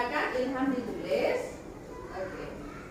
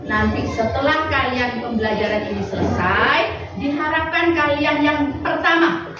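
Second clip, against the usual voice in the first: formal announcement; 4 semitones lower, 6 dB louder than usual. Real, not acted. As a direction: announce the lesson goals to the class. Teacher states lesson goals. (pengumuman resmi guru, lebih keras dan pelan)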